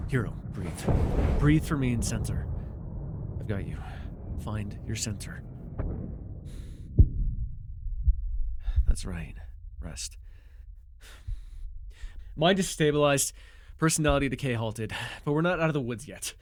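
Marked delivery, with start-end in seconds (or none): 0.1-10.2 softer, lower, soothing voice; calm him down gently. Acted. Calming the injured telepath.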